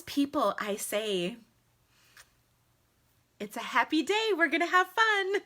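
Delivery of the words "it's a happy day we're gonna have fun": high, cheery mock announcement; chirpy comeback. Streamer answers lipstick critics, high and chirpy.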